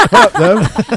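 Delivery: squeaky high laughing "What up"; a giggly falsetto "What up, though"; laughing slang greeting. Pretend. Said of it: "would just go".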